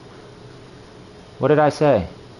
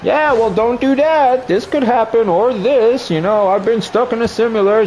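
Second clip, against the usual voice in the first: lecturing elder; 10 semitones higher than usual. Retold or imitated. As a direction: nag like a grown-up. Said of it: "you're sitting here as a kid like".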